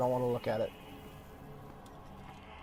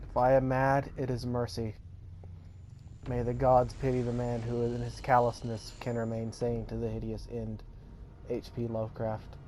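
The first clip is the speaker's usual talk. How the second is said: hushed, grave reading of a quote; softly intoned epigraph; solemn quote reading; 3 semitones lower, 5 dB quieter than usual. A narrator's reading. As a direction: let it sink in, quieter toward the end. Reads the opening quote.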